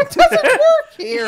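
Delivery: squeaky laughing, high and loud; mock the clerk through laughter; laughing mockery. Mocking the desk clerk's line.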